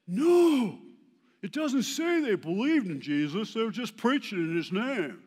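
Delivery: loud, high-pitched protest, imitating objectors; mock outcry. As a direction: cry out in exaggerated objection, starting on a long high "no". Pastors' objection acted out at conference.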